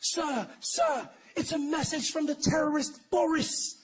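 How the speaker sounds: high, breathless alarm; urgent assistant. Retold or imitated. Assistant rushing in to the United Nations, high urgent alarm. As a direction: burst in with the news, pitched high. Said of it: "The assistant runs in".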